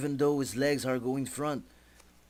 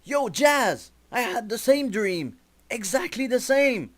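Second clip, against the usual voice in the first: much higher, eager, hyped voice; excited neighbour; 11 semitones higher, 5 dB louder than usual. Acted. The man in the next bed tells Jazz about the dream, excited. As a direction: burst in like an excited goofy stranger.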